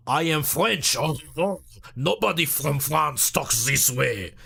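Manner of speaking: hammed-up cartoon French accent, drawn out. Shown as a demonstration. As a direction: do a slow, over-the-top French cartoon accent. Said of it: "you get the cartoon run".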